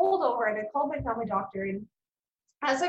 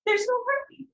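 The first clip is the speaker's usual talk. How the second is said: high-pitched, distressed retelling of a tearful call; upset, high re-enacted plea; retold crying; 11 semitones higher than usual. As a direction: push it up high, as if crying to a husband. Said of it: "I just cry, and I say".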